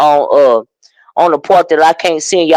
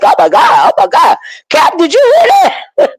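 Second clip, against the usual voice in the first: high-pitched, hammed-up disbelief; mock-scandalized cry; shocked reaction; 13 semitones higher than usual. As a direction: recount your shock, climbing high to the end. Acts out own shocked reaction.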